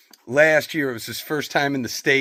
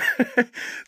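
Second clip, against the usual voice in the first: high laugh; 4 dB quieter than usual. Real, not acted. Laughing at own joke.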